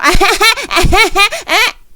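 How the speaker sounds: loud, high mock cackle; over-the-top put-on laugh; imitated laugh. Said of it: "Rachel got a laugh on her".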